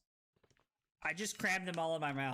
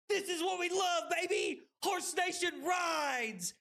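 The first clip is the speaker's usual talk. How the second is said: high, rallying cheer; rally the fans with a high shout; hyped cheering; 10 semitones higher than usual. Real, not acted. Streamer rallying Horse Nation, hyped cheer. Celebrating a huge in-game hit.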